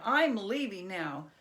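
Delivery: loud, huffy goblin storming off; angry goblin. Acted. Goblin quits the game in a huff, loud and sulky. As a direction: stomp out of the game in a sulk. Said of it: "goblin storms off angry".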